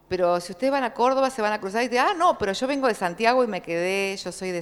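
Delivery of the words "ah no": usual speaking voice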